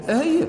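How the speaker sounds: œil said high and loud as a model word; pronunciation example. Shown as a demonstration. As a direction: hold up the word œil for the class. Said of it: "vous prononcez œil".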